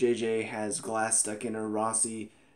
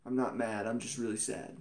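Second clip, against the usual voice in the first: pissed-off sad, imitated; 3 semitones lower, 7 dB quieter than usual. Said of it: "she seems pissed off".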